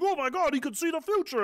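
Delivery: mocking gush. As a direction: gush like a starstruck fan, mockingly. Mocking gushing fans.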